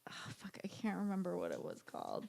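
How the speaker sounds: hushed whisper to herself; trailing whisper trying to recall; whispered aside. Real, not acted. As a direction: whisper it while searching memory. Forgetting name, muttered aside.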